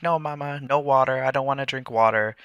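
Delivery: put-on whiny toddler refusal. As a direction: play the little girl refusing her water. Toddler refusing water, quoted.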